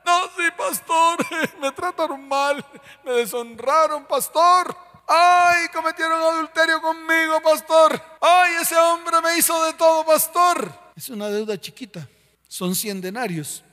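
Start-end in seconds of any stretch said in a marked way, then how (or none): 0.0-10.7 higher, louder mocking whine of a wronged believer; mock a church member moaning to the pastor; whiny complainer. Pastor mimics a congregant complaining about offenses, whiny mock voice.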